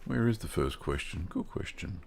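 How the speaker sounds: a soft, voiceless whisper to himself; whispered aside. Real, not acted. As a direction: mutter it under your breath, barely audible. Muttering to himself while searching.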